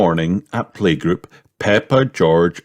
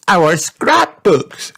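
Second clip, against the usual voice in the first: high, slow delighted cheer; child cheering about her scrapbook; 11 semitones higher, 4 dB louder, slower than usual. A narrator's reading. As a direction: cheer it out with joy. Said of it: "cheered Peppa".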